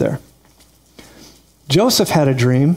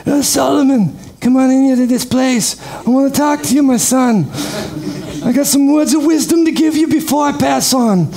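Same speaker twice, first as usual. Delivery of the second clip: Godfather voice; 9 semitones higher than usual. Retold or imitated. Old King David instructing his son Solomon, as the Godfather. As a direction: talk like a Godfather with cotton in your mouth. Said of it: "David talked like the Godfather".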